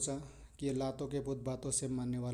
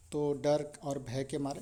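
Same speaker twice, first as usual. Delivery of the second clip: ordinary talk, nothing marked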